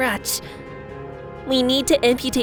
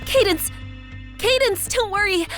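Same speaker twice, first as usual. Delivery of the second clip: high, insistent call; urgent reassurance; 6 semitones higher than usual. Acted. Rushing to Cadance to say help is here, urgent high voice. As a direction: call her high, then reassure quickly.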